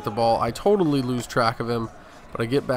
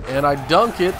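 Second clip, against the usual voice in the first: higher, louder burst as the ball goes down; excited shout; 4 semitones higher, 7 dB louder than usual. Real, not acted. Gamer calling his own dunk in play, excited shout. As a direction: yell it like you just threw it down.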